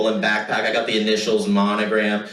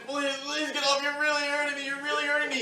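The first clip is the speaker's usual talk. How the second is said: pinned in a chokehold, yelling for mercy; panicked begging; 7 dB quieter, faster than usual. Retold or imitated. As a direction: beg him to let go, voice straining high.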